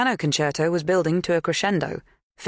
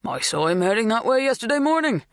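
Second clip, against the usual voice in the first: apologetic; 7 semitones higher, 3 dB louder than usual. A narrator's reading. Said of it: "He sounded apologetic".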